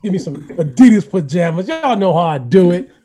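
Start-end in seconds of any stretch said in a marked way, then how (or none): none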